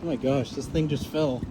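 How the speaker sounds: ordinary delivery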